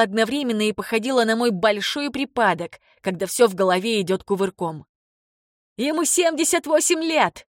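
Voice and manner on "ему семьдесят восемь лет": high-pitched outraged cry; woman's shout. A narrator's reading. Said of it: "закричала женщина".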